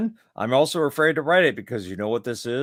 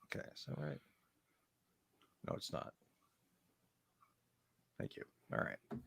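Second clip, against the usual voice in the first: quiet aside; 4 semitones higher, 21 dB quieter than usual. Real, not acted. Aside while silencing phone.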